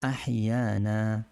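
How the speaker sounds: drawn out syllable by syllable, আহিয়ানা; slow demonstration. Shown as a demonstration. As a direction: spell out আহিয়ানা slowly for learners. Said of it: "মূল উচ্চারণ আহিয়ানা". (ধীরে ধীরে উচ্চারণ)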